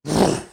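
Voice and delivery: a loud, sharp horse snort through the lips; war horse snort. Shown as a demonstration. Preacher imitates a war horse snorting, loud and sharp. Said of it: "it goes".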